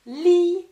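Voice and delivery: li pushed out loud; syllable sounded out. Shown as a demonstration. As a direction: punch li as its own sound. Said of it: "et puis j'entends".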